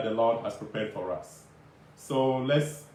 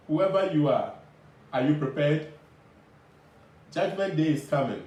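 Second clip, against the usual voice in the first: doomsday street preacher. Acted. Preaching the judgment message.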